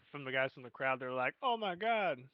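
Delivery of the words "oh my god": mildly mock-shocked, pitched higher; crowd's shocked exclamation. Imitating the crowd reacting, shocked.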